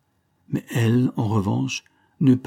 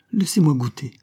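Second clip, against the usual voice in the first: mildly exclaimed, higher-pitched plea; eager cry; 6 semitones higher, 4 dB louder than usual. A narrator's reading. Dancing girl begging Graham for the cup, eager cry. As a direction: call out eagerly for a taste. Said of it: "s'écria la danseuse".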